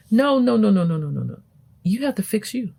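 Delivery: ordinary delivery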